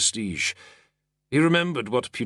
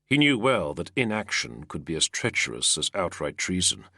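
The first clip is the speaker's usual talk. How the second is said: plain reading voice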